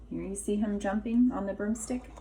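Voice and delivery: ordinary delivery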